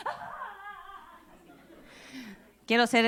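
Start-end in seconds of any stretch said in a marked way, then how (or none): 0.1-1.2 high, very soft mock crying wail; a thin wailing sob, acted; hammed-up crying whimper; imitated wail. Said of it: "con ese lloro de".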